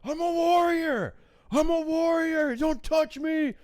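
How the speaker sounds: high, loud, slow chant of defiance; high shouted war cry of a drunk heckler; defiant heckler yell. Retold or imitated. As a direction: bellow it like a deranged warrior. Heckler defying security.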